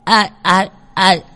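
put-on high, timid child calling out; a boy asking who's there. Tèo gọi hỏi trong đêm. (giọng cậu bé gọi hỏi)

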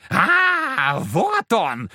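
high shrill devil shriek; squealing devil. Acted. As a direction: shriek it like a possessed man. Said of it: "взвизгнул чёрт голосом Фоки".

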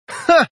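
one high, harsh mocking "ha"; witch's contemptuous laugh at the captain; angry bark of a laugh. A narrator's reading. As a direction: spit out a single scornful laugh. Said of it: "зло хохотнул Рауман".

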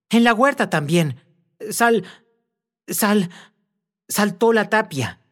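high, stumbling over the name in fright; breathless frightened stutter; panicked stammer. A narrator's reading. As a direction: gasp it out, tripping on the syllables.

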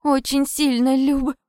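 high, slow plea broken mid-word; pleading, cut off. A narrator's reading. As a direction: plead desperately until cut short. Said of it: "Я не успела договорить".

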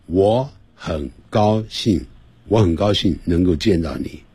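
each Chinese character given equal length; 我很高兴能够见到你 evenly, nothing stretched; even syllable timing. Shown as a demonstration. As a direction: say 我很高兴 in short, evenly timed syllables. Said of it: "通常是同样的时间".

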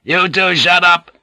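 high, sharp shout; shouted order. A narrator's reading. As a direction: shout them both into silence. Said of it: "he shouted".

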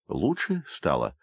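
slow, low, mildly teasing question; joking tone. A narrator's reading. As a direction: tease gently, taking your time. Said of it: "шутливым тоном произнёс". (слегка шутливым тоном)

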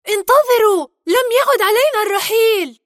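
loud, high, urgent shout; excited call. Acted. Macintosh calls to the departing tribe, excited shout. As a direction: call out eagerly to stop everyone leaving. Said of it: "نادى ماكنتوش بحماس".

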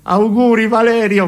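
high-pitched festive well-wishing; celebratory, pitched well up; excited New Year greeting. Acted. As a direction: cheer your friend at midnight. New Year greeting after the countdown.

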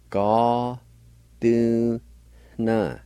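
"Ga, du, na" in separate syllables, faint H before nasal N; nasalized N. Shown as a demonstration. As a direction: slowly sound out "Ga, du, na", breathing into the N. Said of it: "last part has a nasalized N sound".

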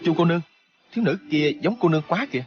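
high-pitched, astonished exclamation; voice cracking. A narrator's reading. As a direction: point it out with a startled lift. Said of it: "lạc cả giọng nói".

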